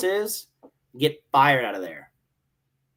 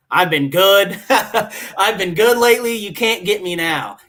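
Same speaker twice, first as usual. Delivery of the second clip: loud, raised-pitch defiant boast; protesting innocence; 6 semitones higher, 8 dB louder, slower than usual. Retold or imitated. Marine insisting to ops chief he's been good, loud cocky protest. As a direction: crow it out loudly, half laughing. Acting out his denial to chief.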